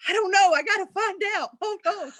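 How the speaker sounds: bubbling over, half laughing; eager excited. Retold or imitated. Acting her eager younger self.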